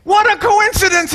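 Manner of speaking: a rabbi bursting with wonder; excited rabbi. Retold or imitated. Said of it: "he said".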